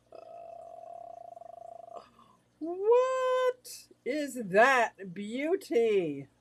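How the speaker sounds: loud, high, hugely drawn-out wonder; awed reveal. Real, not acted. Host reveals the beauty to viewers, awed. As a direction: stretch it out in loud amazement.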